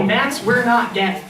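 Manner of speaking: ordinary talk, nothing marked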